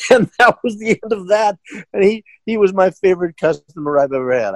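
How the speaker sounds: high voice breaking into laughter; laughing wrap-up. Real, not acted. Storyteller closing the tale of his worst customer, laughing. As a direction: finish the story while really laughing.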